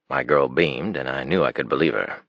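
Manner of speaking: ordinary delivery, nothing marked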